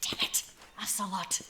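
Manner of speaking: angry whisper under the breath; whispered curse. Acted. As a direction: curse quietly to yourself. Cursing the enemy under breath.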